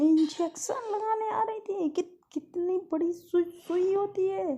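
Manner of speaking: high, crying complaint; whimpering cry. Acted. Girl crying to her caretaker about the big needle. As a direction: whine through tears. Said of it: "तो कहती".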